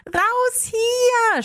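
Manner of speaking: high urgent yell; girl yells to flee the collapsing cave; shout. Acted. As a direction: scream it as the cave falls. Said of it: "schrie Leonie".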